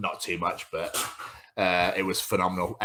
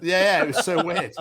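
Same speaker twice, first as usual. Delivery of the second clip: laughing; 9 semitones higher, 5 dB louder than usual. Real, not acted. Laughing after funny story.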